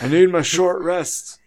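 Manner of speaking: put-on slow, high, loud old-timer complaint; hammed-up elderly weariness; worn-out old adventurer. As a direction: plead like an exhausted geriatric hero. Playing worn-out geriatric adventurer.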